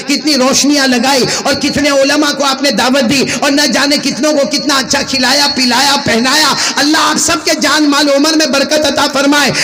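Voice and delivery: rising pulpit fervour; fiery preaching. Acted. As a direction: preach it out to the whole gathering. Preacher exhorts the crowd.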